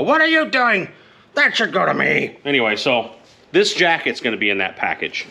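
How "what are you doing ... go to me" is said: a higher, mock-indignant father; dad's complaint. Son imagines his dad claiming the jacket, a mock-indignant high voice. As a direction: pretend to be his old man protesting. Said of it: "he's gonna see this video and go".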